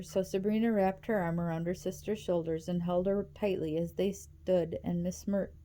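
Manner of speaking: ordinary delivery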